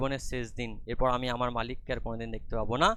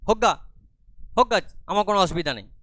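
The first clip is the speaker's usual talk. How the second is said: angry retort; 7 semitones higher, 7 dB louder, faster than usual. Acted. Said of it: "লীলা রেগে মেগে".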